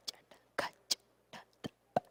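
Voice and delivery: Cha, Ta, Ka breathed out with no voice; whispered syllables. Shown as a demonstration. Teacher proving voiceless letters stay audible, whispering. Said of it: "You can say them without using your voice".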